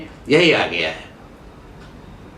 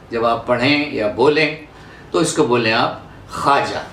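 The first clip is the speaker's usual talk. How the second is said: ordinary talk, nothing marked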